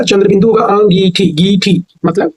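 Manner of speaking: normal talking voice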